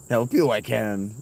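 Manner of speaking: slow, strange Yoda voice; little green Jedi master voice. Pretend. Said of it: "[name]'s native language is Yoda".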